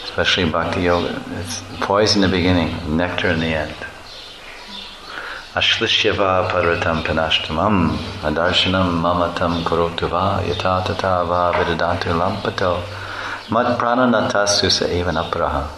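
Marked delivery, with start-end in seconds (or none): 5.5-15.7 measured Sanskrit chant-like recitation; verse recitation. Acted. Teacher reciting Gita verse, devout recitation.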